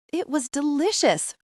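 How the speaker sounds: high-pitched, hasty compliment; hasty praise. A narrator's reading. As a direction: rush to please with praise. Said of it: "I say hastily".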